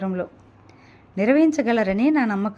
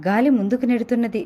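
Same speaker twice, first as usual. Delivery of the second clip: ordinary delivery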